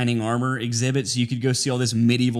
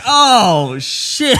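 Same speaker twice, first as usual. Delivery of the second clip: higher, louder, caught-out "oh shit"; mock surprise; 8 semitones higher, 6 dB louder than usual. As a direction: realize you've been fooled. Retold reaction to reveal.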